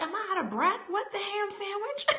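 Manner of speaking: high, hushed mock shock; mock disbelief. Retelling being winded on stage, high hushed mock disbelief. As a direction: pretend baffled at own breathlessness. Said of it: "And I'm saying".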